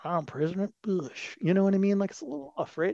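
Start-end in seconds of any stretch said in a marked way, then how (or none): 0.0-1.4 lightly imitated Southern twang; mock Southern politician, a touch softer; George W. Bush. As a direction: put on a not-quite-Southern presidential twang. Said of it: "he always had this kind of twang".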